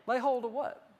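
usual speaking voice

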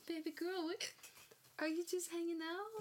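talking to a dog. Real, not acted. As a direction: coo at the dog.

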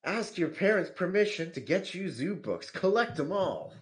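put-on high-pitched kids' ad announcer parody; mock commercial. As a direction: pitch it like a children's TV advert. Mimicking an old children's commercial.

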